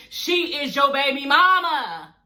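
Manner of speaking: slow, drawn-out punchline; emphatic gossip. Real, not acted. Telling Michael off about the pregnancy, drawn-out and emphatic. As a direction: stretch it out to rub it in.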